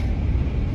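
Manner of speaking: short breathy laugh of frustration; exasperated laugh. Real, not acted. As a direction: laugh instead of crying. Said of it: "I just laugh because I want".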